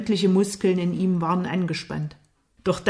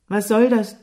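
higher, louder reproach; indignant challenge; 3 semitones higher, 7 dB louder than usual. A narrator's reading. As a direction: call him out sharply. Lukas stellt den wütenden Jimmy zur Rede. (empört und vorwurfsvoll)

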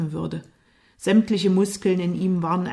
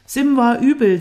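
raised, higher voice in protest; heated defence; 6 semitones higher, 9 dB louder than usual. A narrator's reading. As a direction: protest loudly against the accusation. Lukas verteidigt sich im Streit. (aufgebracht sich verteidigend)

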